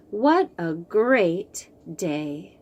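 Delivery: plain reading voice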